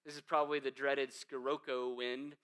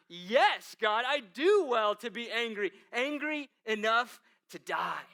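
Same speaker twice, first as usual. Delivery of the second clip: higher, louder stubborn defiance toward God; defiant Jonah; 6 semitones higher, 6 dB louder, slower than usual. Acted. Jonah answering God stubbornly, defiant and angry. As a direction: double down like a sulking prophet. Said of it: "he doubles down".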